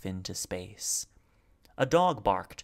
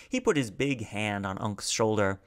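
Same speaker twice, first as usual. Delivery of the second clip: ordinary delivery, nothing marked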